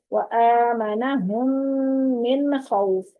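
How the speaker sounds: slow recited verse with clear stops; 1 slow Quran recitation. Shown as a demonstration. Teacher recites the next verse to the class. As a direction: recite it slowly for students to follow. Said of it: "फिर व आमना".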